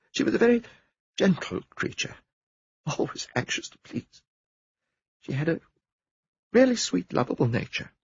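a touch of a breaking voice; voice breaking a little. Acted. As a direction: let the composure slip. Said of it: "matter-of-fact voice broke a little".

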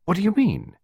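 high, sharp cutting-in question; sharp interruption. Acted. Clarissa to Costello, interrupting him sharply and high. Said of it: "Clarissa interrupted him sharply".

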